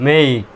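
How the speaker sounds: মেইক with a long drawn-out "ei" glide; long vowel. Shown as a demonstration. Teacher shows learners the long sound in মেইক, drawn out. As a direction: say মেইক slowly, stretching the vowel. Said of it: "তার মানে এখানে long sound হবে".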